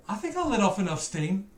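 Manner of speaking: normal talking voice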